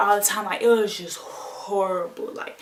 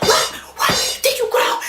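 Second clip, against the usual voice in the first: loud, explosive retold shouts; hollered angry questions; enraged mom; 6 dB louder, slower than usual. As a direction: imitate a furious mother mid-beating. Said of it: "I've never seen my mom that angry".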